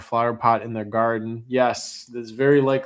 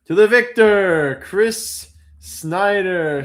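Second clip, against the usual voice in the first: higher, louder victory announcement; grand announcement; 7 semitones higher, 6 dB louder, slower than usual. Real, not acted. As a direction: hail the winner with a flourish. Announcing the auction winner.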